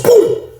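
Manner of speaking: explosive high "boom"; punch boom. Retold or imitated. Storyteller mimicking the blow landing in his fight, explosive. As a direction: punch out the impact noise.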